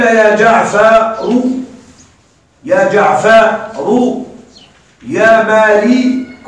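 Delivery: each vocative drawn out very slowly; slow example calls. Shown as a demonstration. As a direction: spell out every call slowly, like on a board. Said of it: "إذا قيل".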